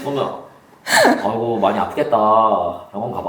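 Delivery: low, hammed-up caring teacher; theatrical sympathy. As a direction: play the worried teacher a bit too much. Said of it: "왜 이렇게 연극하시는 거 같아요".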